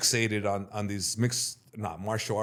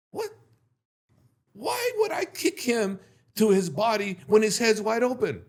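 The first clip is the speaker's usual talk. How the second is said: high, louder incredulous rant; indignant disbelief; 12 semitones higher, 5 dB louder than usual. Retold or imitated. Telling himself the move is stupid, high indignant rant. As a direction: scoff at the silly technique.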